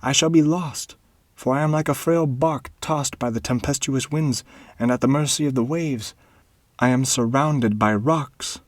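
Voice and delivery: put-on a young man lost in a storm of debts; pleading baron. A narrator's reading. Ernest pleads with the dwarf, high and despairing. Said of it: "continued Ernest".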